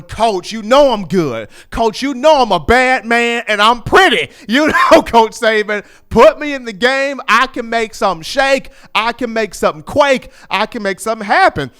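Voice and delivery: hyped player begging to be put in; eager begging. Retold or imitated. Player begging coach to play.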